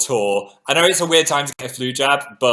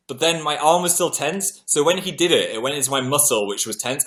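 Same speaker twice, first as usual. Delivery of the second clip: ordinary talk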